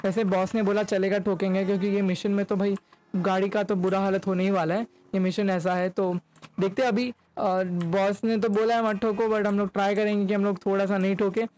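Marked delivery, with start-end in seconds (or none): none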